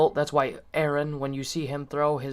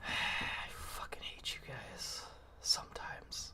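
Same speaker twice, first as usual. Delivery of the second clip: slow exasperated whisper, with a sigh; 6 semitones lower, 16 dB quieter, slower than usual. Real, not acted. Muttering at teasing friends.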